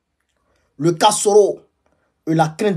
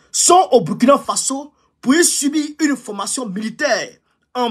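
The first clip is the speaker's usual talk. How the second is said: higher formal report read; official report reading; 6 semitones higher than usual. Shown as a demonstration. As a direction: read the intelligence report aloud. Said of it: "je vais vous donner lecture du rapport".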